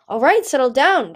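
startled into calming him; surprised. A narrator's reading.

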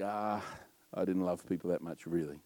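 soft, low, rueful aside; quiet admission. Retold or imitated. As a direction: admit it quietly, as if to yourself. Retelling a rueful realisation.

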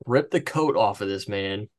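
ordinary talk

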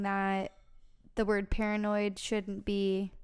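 slowed, drawn-out delivery; slow motion. Pretend. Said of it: "talk in slow motion for this".

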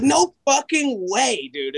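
high, slow mock disbelief; imitating stunned girl. Retelling the girl at the Cape, high stunned disbelief. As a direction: stretch it out like she can't believe it. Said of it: "she's just flipping out even more".

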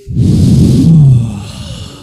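long, loud, low sigh of release; big exhale. Shown as a demonstration. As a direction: let out a big breath slowly. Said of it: "Let's all take a big exhale".

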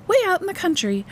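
very high, excited mother voice; infused enthusiasm. A narrator's reading. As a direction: make it sound thrilling to a small child. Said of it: "infusing her voice with enthusiasm again".

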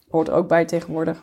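normal talking voice

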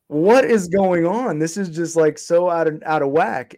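retold disbelief, pitched well up; incredulous lawyer. Lawyer reacts to the reindictment, incredulous. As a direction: imitate a lawyer's high, drawn-out incredulity.

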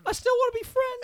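sing-song breakup line; mock Summer.

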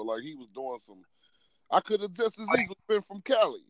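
usual speaking voice

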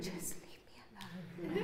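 very soft whisper behind a locked door; hiding whisper. Retold or imitated. Mom hiding in the bathroom whispering to herself. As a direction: barely breathe it out. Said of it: "I lock the door and I'm like".

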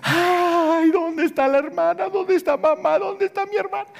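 high, desperate wailing of the left behind; crying out in despair. Retold or imitated. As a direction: imitate people searching frantically for family. (gente que busca a los suyos gritando desesperada)

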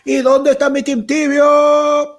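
slow, high, loud hype call; club MC voice. Retold or imitated. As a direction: stretch it out like a disco host. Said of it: "la de animador de discoteca". (animador de discoteca)

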